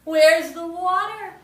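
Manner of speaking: loud, slow, high call; loud, high, slow cry of surprise; surprised outcry. Acted. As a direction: call out in dismay. Rosalma finds fountain dry.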